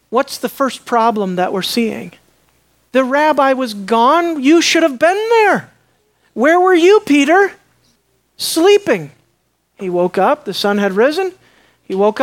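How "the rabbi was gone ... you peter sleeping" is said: alarmed call. Acted. Preacher voices disciples' alarm.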